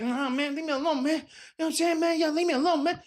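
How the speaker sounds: very high, whiny mock pouting; Jey Uso. Wrestler refusing the interviewer a moment, mock pouty whine. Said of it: "being a pouty little bitch".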